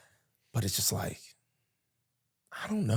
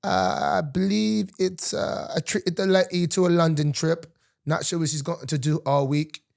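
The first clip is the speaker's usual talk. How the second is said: sustained mock London accent. Guest explaining the dinner date, mock London accent. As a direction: answer as a pretend Londoner. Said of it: "Is that a London accent".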